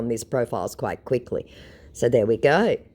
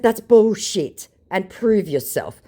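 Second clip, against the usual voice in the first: slow blunt retort; 7 semitones higher, 4 dB louder, slower than usual. Retold or imitated.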